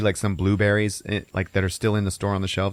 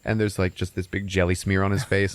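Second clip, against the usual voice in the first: ordinary delivery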